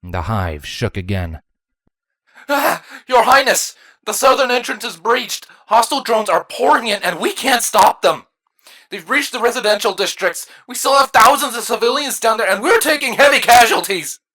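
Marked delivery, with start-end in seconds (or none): 2.5-14.2 high, frantic battle report; high-pitched desperate alarm; panicked. A narrator's reading. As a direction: cry out the news in panic, growing louder. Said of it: "A panicked voice spoke out".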